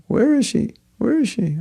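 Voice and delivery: high, anxious calling for the lost doe; anxious searching. Retold or imitated. Maharaj Bharat searching frantically for his lost doe. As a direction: call out for her in a worried voice.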